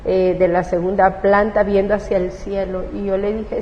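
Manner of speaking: ordinary delivery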